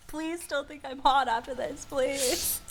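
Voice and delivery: high, giggling mock begging; joking plea to listeners, giggly and high; laughing plea. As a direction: beg while laughing.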